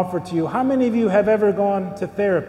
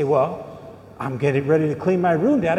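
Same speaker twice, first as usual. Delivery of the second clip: lower, quicker, mock-innocent kid; kid's lower excuse; 4 semitones lower than usual. Kid caught watching TV answers his dad, lower and hurried. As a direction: drop the voice a little and hurry the fib.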